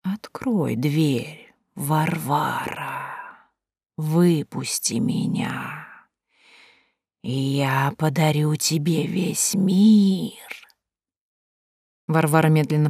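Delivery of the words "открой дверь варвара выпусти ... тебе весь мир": very slow, soft eerie whisper; singsong whisper. Acted. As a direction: coax her to open the door. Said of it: "Жуткий шёпот".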